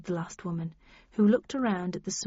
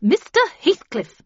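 high, loud, swooping reprimand; imperious matron's rebuke; stern; 9 semitones higher, 8 dB louder than usual. A narrator's reading. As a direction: pull up a latecomer by name. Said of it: "said Miss Havisham sternly".

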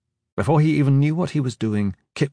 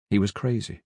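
hushed, matter-of-fact statement; understated; 2 semitones lower, 5 dB quieter than usual. A narrator's reading. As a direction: say it quietly, dropping the level. Same words, first quieter take.

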